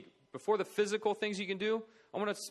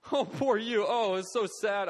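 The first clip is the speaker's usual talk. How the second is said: loud, higher mock wail; mock crying pity; 4 semitones higher, 6 dB louder than usual. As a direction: overdo the tearful sympathy. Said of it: "Does the doctor cry?".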